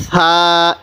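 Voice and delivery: সা with a soft lisped th-like onset, vowel held; teacher's soft, drawn-out demo of সা; soft articulation. Shown as a demonstration. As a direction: ease gently into a long level সা. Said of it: "একটু নরম করে উচ্চারণ করব".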